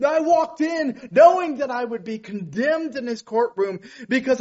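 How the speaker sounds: loud, high, rising preacher's call; full-force preaching. Acted.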